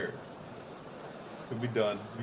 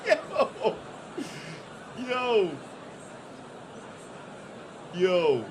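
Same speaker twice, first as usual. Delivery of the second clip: yo through laughter, pitched up; laughing; 5 semitones higher, much slower than usual. Real, not acted. Streamer laughing at delegates leaving, high yo.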